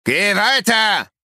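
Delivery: hoch, langsam, gerufen; fremde Stimme. A narrator's reading. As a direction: ruf es aus der Ferne herüber. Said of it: "wehte eine fremde Stimme". (gerufene fremde Stimme)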